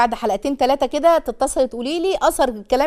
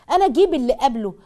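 usual speaking voice

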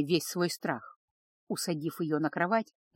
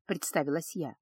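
ordinary delivery, nothing marked

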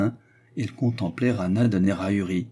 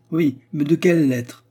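high, loud taunting question; emphatic scornful query; pointed question; 8 semitones higher, 6 dB louder than usual. A narrator's reading. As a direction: challenge with raised pitch and volume. Mocking question, then echoed in surprise.

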